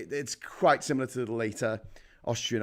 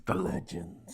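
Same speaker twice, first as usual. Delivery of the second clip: hushed, drawn-out echo of a legend; mock-mysterious echo; 5 semitones higher, 4 dB quieter, slower than usual. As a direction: whisper it like a spooky echo. Echoes the word, mock-mysterious.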